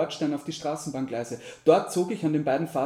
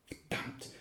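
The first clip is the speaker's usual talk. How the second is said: voiceless, much softer swearing; whispered curse; 13 dB quieter than usual. A narrator's reading. Driver reaching only her voicemail, whispered curse. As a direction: curse under your breath. Flucht nach der Mobilbox.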